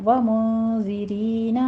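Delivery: ومنذرین slowly with a hidden nasal نون; slow tajweed ومنذرین; tajweed recitation. Shown as a demonstration. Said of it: "نون ساکن کا قاعدہ اخفا حقیقی کریں گے،".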